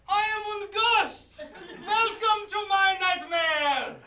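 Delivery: slow high spooky ghost. Acted. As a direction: moan it out like a ghost. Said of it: "The ghost".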